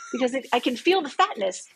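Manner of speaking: ordinary talk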